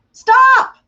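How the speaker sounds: a sudden high, loud STOP; sharp stop. Shown as a demonstration. Teaching the STOP method, a sharp loud command. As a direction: bark it like a command to halt.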